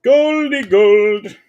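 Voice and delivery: slow, high, loud sing-song; high drawn-out gleeful chant; excited. Real, not acted. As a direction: stretch it out with glee. Gold card reveal.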